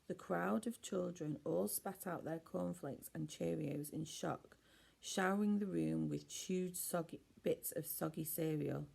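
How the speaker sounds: ordinary delivery, nothing marked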